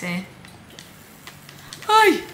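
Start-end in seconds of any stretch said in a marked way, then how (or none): 1.9-2.2 startled yelp. Real, not acted. Falls during final round.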